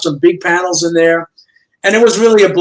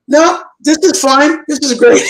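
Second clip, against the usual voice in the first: eager delight; 8 semitones higher than usual. Retold or imitated. Artist accepting the editor's thumbnails, delighted and high. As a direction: gush with grateful relief.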